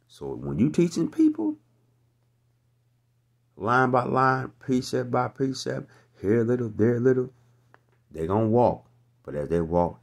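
high, loud shouted rebuke; preacher at full roar; fiery preaching. Real, not acted. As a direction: blast the scoffers from the pulpit. Preacher still at full force.

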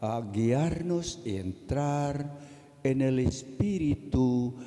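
ordinary talk

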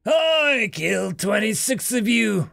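defiant pinned soldier rasps; rasped. Acted. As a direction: rasp it out, wounded but proud. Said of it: "rasped the figure".

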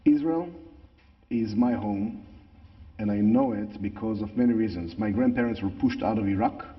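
English with a natural Israeli accent. Real, not acted. Same speaker, personal statement.

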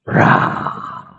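breathy roaring dragon. Pretend. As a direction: let out a dragon roar. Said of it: "your dragon roar in public".

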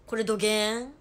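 loud, punchy Hakata dialect; forceful demo of heavy Hakata dialect; thick Hakata dialect. Shown as a demonstration. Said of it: "どぎつい博多弁喋って".

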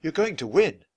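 usual speaking voice

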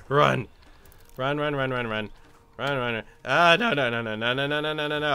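panicked, breathless running; being chased. Real, not acted. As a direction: flee in a panic. Chased by monster in game.

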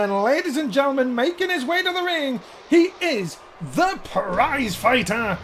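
slow drawn-out ring intro; ring announcer. Acted. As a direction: stretch the name out like an arena announcer. Introduces wrestler entering ring.

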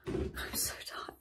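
breathy whispered complaint, slow. Shown as a demonstration. Vlogger whispering to camera that she is tired. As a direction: barely breathe out how tired you are. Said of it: "repeated tired phrase, whispered".

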